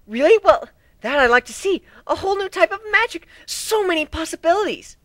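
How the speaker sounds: thrilled by a new kind of magic; geeking out. Acted. Twilight geeking out over new magic, high and eager. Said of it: "Twilight began to geek out".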